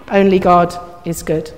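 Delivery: low, soft "Only God is good"; hushed repeat. Acted. Preacher repeating Jesus's answer to the congregation, hushed. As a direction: let it sink in quietly, pitched low. Softer repeat of the quoted line.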